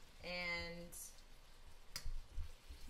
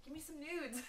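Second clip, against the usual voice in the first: mock begging; 8 semitones higher, 4 dB quieter than usual. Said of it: "And so I'm like".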